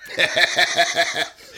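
high, wild cackling laugh impression; Kevin McCreary laugh. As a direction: cackle high and loud like a friend. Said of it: "let me do my Kevin McCreary impersonation".

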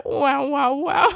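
put-on high giggly baby voice; cutesy baby voice. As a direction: squeal it like a baby. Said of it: "do your baby impression again".

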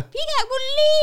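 high, loud whiny fan voice, far above her own; a hammed-up high complaint; mock fan voice. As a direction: squeal it like an outraged fan. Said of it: "ก็แบบ".

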